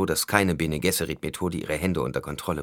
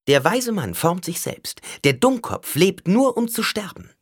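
high, loud biting tone; caustic sharpness; 12 semitones higher, 6 dB louder than usual. A narrator's reading. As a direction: cut him with acid scorn, high and loud. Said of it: "Ihr Ton war von ätzender Schärfe". (ätzend scharf, hoch und laut)

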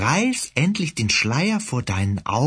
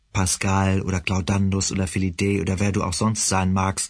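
ordinary delivery, nothing marked

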